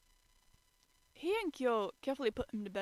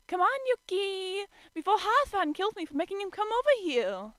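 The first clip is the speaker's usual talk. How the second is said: coaxing; 10 semitones higher, 8 dB louder than usual. A narrator's reading. Shigure urging sleeping Yuki to wake, coaxing. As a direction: wheedle him awake.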